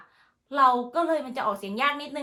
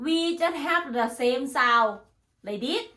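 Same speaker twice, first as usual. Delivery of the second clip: ordinary talk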